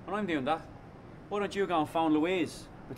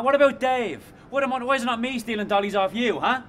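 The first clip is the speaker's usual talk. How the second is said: raised, heated jealous rant; resentful friend ranting at his mate; angry rant; 6 semitones higher than usual. Acted. Jealous quarrel.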